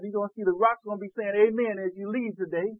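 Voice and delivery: ordinary talk, nothing marked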